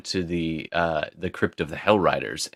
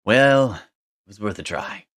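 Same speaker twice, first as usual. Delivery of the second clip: ordinary talk, nothing marked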